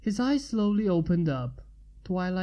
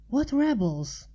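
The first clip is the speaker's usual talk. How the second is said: higher, alarmed question; Twilight voice, urgent; 6 semitones higher than usual. A narrator's reading. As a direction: press him for answers.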